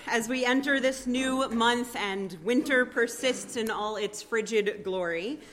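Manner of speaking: strong, projected pulpit voice; formal sermon delivery. Acted. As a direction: proclaim it to the congregation. Preaching welcome words at full force.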